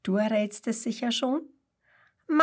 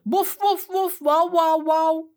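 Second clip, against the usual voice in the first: high, louder mock barks; barking like a dog; 6 semitones higher, 5 dB louder than usual. A narrator's reading. Kangaroo pretending to be a dog, loud high barks. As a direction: bark it out playfully. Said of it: "bellt laut".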